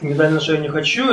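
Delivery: ordinary talk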